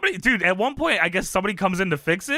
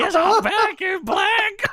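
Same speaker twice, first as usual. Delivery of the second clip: high, drawn-out laughing cry; mock elation; 3 dB louder, much slower than usual. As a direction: crow it slowly through laughter. Joking acted exclamation while laughing.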